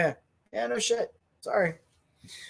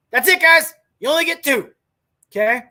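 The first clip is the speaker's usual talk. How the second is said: much louder and higher, insistent; shouting; 8 semitones higher, 8 dB louder than usual. Real, not acted. Telling the audience there are only two, loud shout. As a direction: shout it down as final. Closing the rant to viewers.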